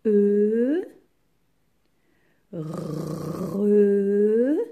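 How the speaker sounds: E and re each held long and loud, a pause between; isolated syllables. Shown as a demonstration. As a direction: sound out E, then re, stretching each vowel. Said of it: "il faut quatre syllabes".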